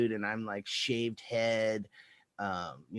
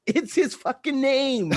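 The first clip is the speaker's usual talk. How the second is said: amused cop; 9 semitones higher, 5 dB louder than usual. Retold or imitated. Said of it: "he goes".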